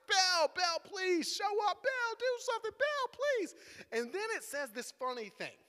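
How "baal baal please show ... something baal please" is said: high, loud, climbing mock plea; desperate shouting. Prophets begging Baal for a sign, shouting even louder. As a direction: shout to the heavens, pitch climbing to a strained peak. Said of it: "started to shout even louder".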